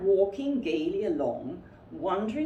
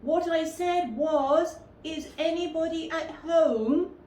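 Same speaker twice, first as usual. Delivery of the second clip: very loud call; 10 semitones higher, 5 dB louder than usual. A narrator's reading. Pooh repeating his question to Rabbit, very loudly. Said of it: "called out Pooh very loudly".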